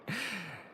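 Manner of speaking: short dismissive chuckle, breathy and soft; a quiet mocking laugh at the rival's pride; scoffing laugh. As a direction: laugh her off with contempt.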